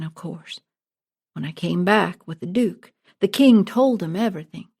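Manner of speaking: ordinary delivery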